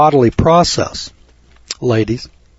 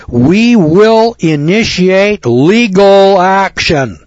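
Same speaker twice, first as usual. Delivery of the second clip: slow, higher and louder, every word hammered; ominous legal threat; 6 semitones higher, 4 dB louder, much slower than usual. Retold or imitated. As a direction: read the threat as a looming menace. Climax of the threatening letter.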